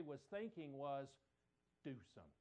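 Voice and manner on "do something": low, soft mother's hint; quiet pointed hint. Retold or imitated. Mother quietly nudging her son to act. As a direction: nudge your son under your breath. Mary's unspoken pointed thought.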